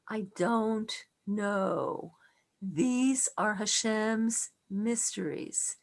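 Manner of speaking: slow, soft, tender admission of not knowing; slow hushed sympathy to a crying teen; soft consoling. Retold or imitated. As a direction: gently comfort a grieving boy. Rabbi consoling grieving boy softly.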